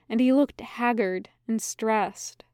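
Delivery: usual speaking voice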